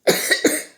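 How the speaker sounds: harsh real cough; coughing fit. Real, not acted. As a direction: cough hard mid-sentence. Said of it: "J'ai une quinte de tout".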